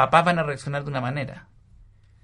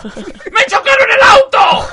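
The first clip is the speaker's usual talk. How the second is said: much higher, louder, drawn-out outraged curse; furious shout; 13 dB louder, much slower than usual. Retold or imitated. Imitating his father discovering the dented car, furious shout. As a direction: roar like a father finding his car wrecked. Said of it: "Le digo".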